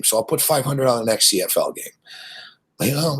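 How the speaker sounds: usual speaking voice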